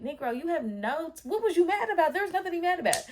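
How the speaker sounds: high, loud exasperated disbelief; indignant outburst. Retold or imitated. Woman answering boyfriend's apology in hindsight, indignant outburst. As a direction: challenge his excuse, pitch climbing as it builds.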